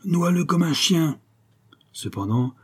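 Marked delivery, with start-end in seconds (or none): 0.0-1.2 high, sustained hostile call; hateful order, pitched well up; attackers' cry. A narrator's reading. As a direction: cry it out from an angry mob. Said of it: "s'écrièrent les assaillants".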